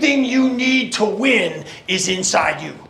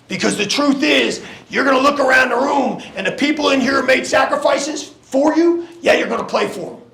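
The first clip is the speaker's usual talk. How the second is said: halftime pep speech; 5 semitones higher than usual. Acted. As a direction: build to a shout. Pep talk climax.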